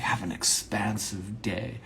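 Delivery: hushed, drawn-out goodbye; soft, slow farewell. Pretend. Speaking to a friend who took mushrooms, soft and slow. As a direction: ease it out slowly and very softly.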